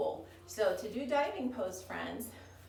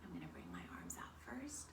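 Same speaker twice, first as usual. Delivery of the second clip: whisper; 10 dB quieter than usual. Real, not acted. Teacher demonstrating a pose to children.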